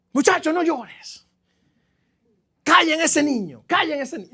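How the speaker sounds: a hint of an annoyed grown-up shushing; mock scolding adult. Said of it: "qué incómodo es cuando la gente llora".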